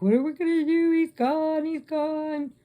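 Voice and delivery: high, wailing retold despair; imitated tearful panic, pitched high; mock crying. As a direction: wail like the stranded friends in tears. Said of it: "and they're crying".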